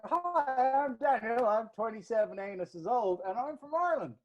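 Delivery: high mock naive self-introduction; mocking imitation of himself. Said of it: "people are like".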